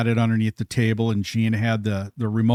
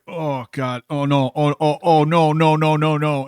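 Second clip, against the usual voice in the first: higher, tumbling run of dismay; mock dread; 4 semitones higher than usual. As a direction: imitate someone helplessly seeing trouble coming. Retells man dreading the prank.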